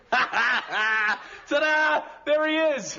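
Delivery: loud, high-pitched ta-da; triumphant reveal. Acted. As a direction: present it proudly like a big finale. Triumphant reveal of last photo.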